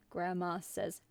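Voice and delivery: ordinary talk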